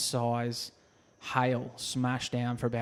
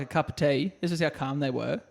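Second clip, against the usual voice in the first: ordinary talk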